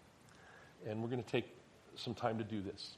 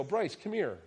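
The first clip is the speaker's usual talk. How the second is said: put-on higher, louder call to a child; 7 semitones higher, 4 dB louder, slower than usual. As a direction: call your kid over. Father calling his son over.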